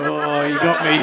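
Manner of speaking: loud mock confession with a laugh in it; DJs caught out. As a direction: own up loudly, like a busted DJ. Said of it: "If any D Js would be like".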